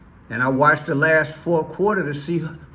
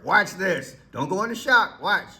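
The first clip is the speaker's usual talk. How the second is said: normal talking voice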